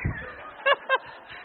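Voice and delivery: high airless laugh; breathless laugh. Real, not acted. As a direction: burst out laughing. Laughing at daddy's line.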